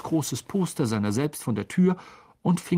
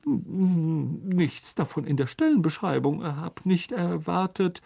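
usual speaking voice

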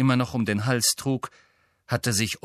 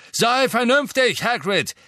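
calling out; 11 semitones higher, 6 dB louder than usual. A narrator's reading. Wizard urging Hagrid to be reasonable, calling out. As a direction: shout it across the grounds. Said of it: "Ein Mann rief".

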